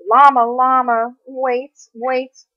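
plain reading voice

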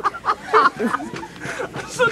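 drawn-out, high, laughing exasperation. Real, not acted. Friend cracking up at the others' foolishness, laughing. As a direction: squeeze the words out through laughter.

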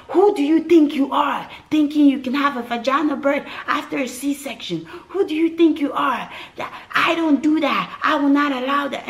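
high, loud indignant doctor yelling. Retold or imitated. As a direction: berate the patient from the doorway. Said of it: "this man was yelling at me".

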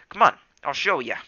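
put-on young cat voice. A narrator's reading. Character voice in story.

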